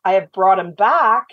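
ordinary talk, nothing marked

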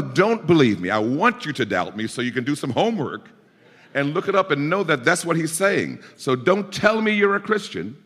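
high, ringing pulpit exhortation; fervent preacher's appeal; preaching at full force. Real, not acted. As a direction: drive it home to the congregation.

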